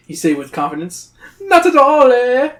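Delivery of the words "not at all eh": high, loud troll sneer; mocking troll.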